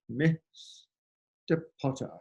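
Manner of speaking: Snape's contempt for Harry; Snape impression. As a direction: stretch it out with cold disdain. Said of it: "He goes".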